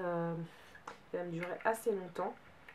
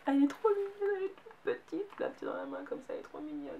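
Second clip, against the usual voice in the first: cooing; 9 semitones higher, 4 dB louder than usual. Real, not acted. Cooing over a cute palette.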